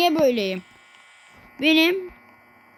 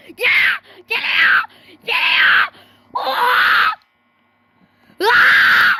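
frightened yells, much louder; panicked shouting; 10 semitones higher, 8 dB louder, much slower than usual. Real, not acted. Gamer yelling at a monster in panic. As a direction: scream in panic as it rushes at you. Monster chases him in game.

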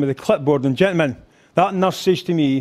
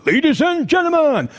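high-pitched emcee introduction; imitated concert announcer, pitched way up; show announcer; 11 semitones higher than usual. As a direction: announce the act in a high, ringing voice.

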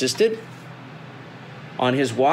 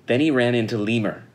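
usual speaking voice

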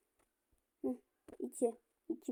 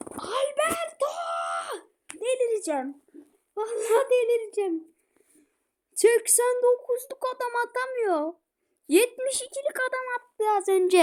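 frustrated yelling; 6 semitones higher than usual. Real, not acted. Gamer fuming that his star striker can't score, frustrated yelling. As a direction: rage at the missed chance.

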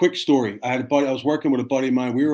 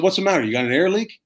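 higher, louder teasing fake concern; mock concern; 6 semitones higher, 4 dB louder, faster than usual. Firefighter teasing a boastful colleague, loud mock concern. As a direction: needle him with mock worry. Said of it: "I said".

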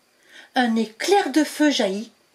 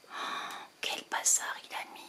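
whispered aside; 15 dB quieter, faster than usual. A narrator's reading. Narrator whispering an aside about the mess.

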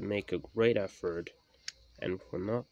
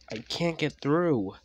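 higher, louder frustration; raised, exasperated voice; frustrated complaint; 7 semitones higher, 7 dB louder than usual. A narrator's reading. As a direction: complain loudly that you are stuck. Stuck engine complains.

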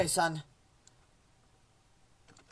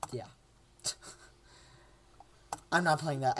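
usual speaking voice

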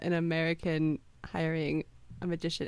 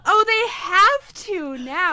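high, slow, louder dramatic longing; swooning fan cry; 10 semitones higher, 8 dB louder, much slower than usual. Pretend. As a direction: sigh it out like a lovestruck fan. Repeats swooning line.

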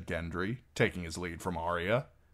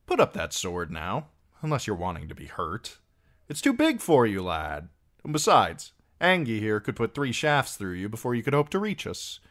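folksy singer, lilting higher and louder in places; lightly voiced singer; 4 semitones higher, 6 dB louder than usual. A narrator's reading. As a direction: lightly play a jaunty roadside minstrel.

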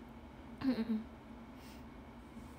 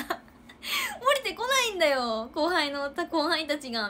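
exasperated complaint; 7 semitones higher, 5 dB louder than usual. Retold or imitated. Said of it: "みたいな".

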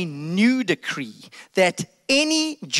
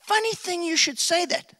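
sly servant; 10 semitones higher than usual. Acted. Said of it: "One of the guys go".

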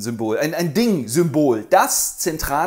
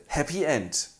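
normal talking voice

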